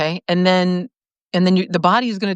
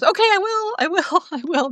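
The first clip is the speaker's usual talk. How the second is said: eager yes; 12 semitones higher than usual. Real, not acted. Accepting a pizza invitation eagerly.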